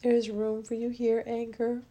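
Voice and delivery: slow, soft healing affirmations; guided affirmation. Acted. Host guiding listeners to welcome each feeling, soft affirmation. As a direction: let each word land gently, slowly. Leads a guided emotional release.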